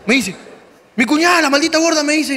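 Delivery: ordinary delivery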